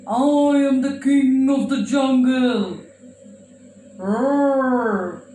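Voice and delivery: lion voice. Acted. Lion introduces himself in puppet show.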